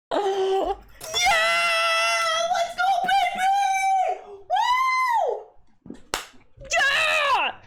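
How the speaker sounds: high-pitched celebratory shouting; real excitement. Real, not acted. Player cheering a big moment in the game, really excited. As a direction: whoop and cheer at full pitch. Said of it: "I got real excited over that shit".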